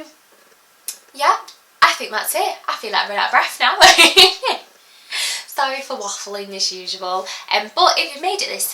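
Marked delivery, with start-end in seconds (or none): 3.8-4.6 breathless laugh. Real, not acted.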